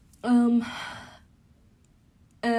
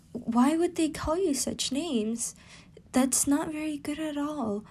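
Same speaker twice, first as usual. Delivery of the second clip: higher, wide-eyed worried question; concerned surprise; 6 semitones higher, 4 dB quieter than usual. Acted. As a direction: ask it higher, shocked and concerned. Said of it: "while Hinata would be wide-eyed, saying".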